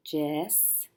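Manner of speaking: ordinary talk, nothing marked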